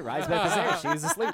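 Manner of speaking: loud narration broken by laughter; laughing loudly. A narrator's reading. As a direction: laugh through the announcement. Repeats the line, laughing and louder.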